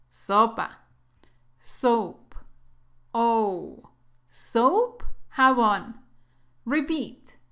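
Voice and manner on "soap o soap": Soap with a long OH glide; soap vowel. Shown as a demonstration. Teacher contrasting soap with soup for learners, careful OH pronunciation. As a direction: isolate OH, then say Soap with that round vowel. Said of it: "No confundas estas dos palabras".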